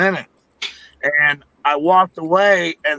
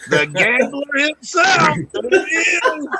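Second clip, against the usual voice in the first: slow, high showman introduction; hammed-up announcer reveal; mock announcer; 10 semitones higher, slower than usual. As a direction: present him like a game-show host.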